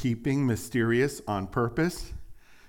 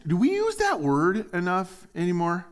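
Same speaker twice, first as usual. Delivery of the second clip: ordinary talk, nothing marked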